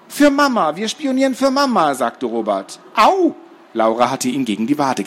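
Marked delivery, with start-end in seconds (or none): 0.1-1.9 put-on high-pitched boy's blurt; blurted confession. Captive boy confesses to the interrogator, blurted out high. 2.9-3.4 loud, high cry of pain; pained yelp. Acted. Boy kicked in the calf, pained yelp.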